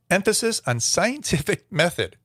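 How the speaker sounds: reading a line while breaking into real laughter, pitched high; can't get through it without cracking up; laughing through it. Real, not acted. Reading the infographic aloud to viewers, laughing. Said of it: "I don't mean to laugh".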